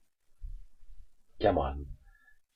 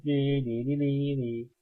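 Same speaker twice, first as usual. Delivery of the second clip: sing-song playground nee-nee, softer; childish taunt; 5 semitones higher, 5 dB quieter than usual. Pretend. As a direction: tease like a kid on the playground.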